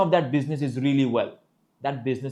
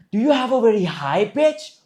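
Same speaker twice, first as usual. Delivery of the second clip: raised, louder, high voice; high-pitched demonstration; 8 semitones higher, 6 dB louder than usual. Shown as a demonstration. Speech coach defining pitch, high-pitched. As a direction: lift the voice up high.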